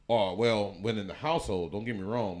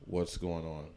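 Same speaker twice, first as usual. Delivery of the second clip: calm dad; 5 semitones lower, 11 dB quieter than usual. Retold or imitated. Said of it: "And he's like".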